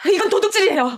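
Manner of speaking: high, loud outraged cry; angry accusation. Acted. Niece accusing her aunt of theft, shouting. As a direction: accuse her at the top of your voice.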